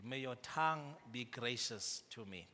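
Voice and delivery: soft, earnest plea to a neighbour; pleading. Shown as a demonstration. Pastor has the congregation plead gently with their neighbour. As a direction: ask it gently, as a real plea. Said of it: "you must plead with these people".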